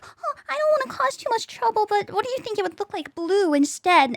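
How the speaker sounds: high, meek hesitant voice; mock a shy client tiptoeing; timid people-pleaser. Mocking timid clients, high meek voice.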